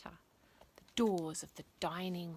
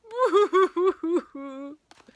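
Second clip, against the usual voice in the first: high, loud hammed-up sobbing wail; loud wailing boohoo; 10 semitones higher, 12 dB louder than usual. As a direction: burst into floods of mock tears. Family bursting into tears.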